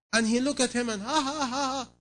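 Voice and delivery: ha ha ha, imitating a visitor's laugh; retold laughter. Preacher imitates the visitor laughing at the patient. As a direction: act out the mocking laugh lightly. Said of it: "he laughed".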